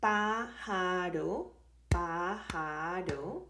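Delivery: PÁJARO drawn out, syllable by syllable. Shown as a demonstration. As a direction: say pájaro slowly in three separate beats. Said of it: "these words have".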